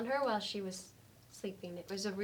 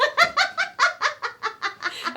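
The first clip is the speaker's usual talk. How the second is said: loud high laugh; 10 dB louder than usual. Acted. Laughing at own impression.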